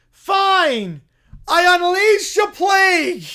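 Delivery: loud, booming curse; shouted curse. Acted. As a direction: bellow the plague. Bear casts plague again.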